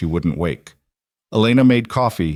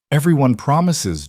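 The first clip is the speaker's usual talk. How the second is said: sobbing; 6 semitones higher than usual. Acted. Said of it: "Juniper sobbed".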